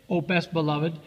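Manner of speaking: plain reading voice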